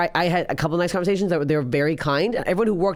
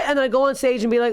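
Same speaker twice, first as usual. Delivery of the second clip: ordinary delivery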